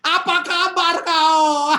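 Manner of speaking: high, loud, slow laughing Medan greeting; Medan shout. Retold or imitated. Medan relatives greeting loudly, shouted with a laugh. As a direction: belt the greeting across the room. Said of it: "Semua suaranya teriak".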